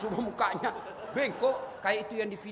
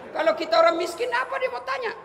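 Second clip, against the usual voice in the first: mock loud question; 9 dB louder than usual. Mocking who questions the poor.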